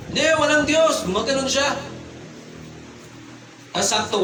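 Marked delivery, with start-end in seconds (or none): 0.1-1.0 high-pitched, insistent contradiction; retold outburst, pitched up; mock drunken retort. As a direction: imitate a drunk man arguing back. Retelling drunk man's shouted challenge.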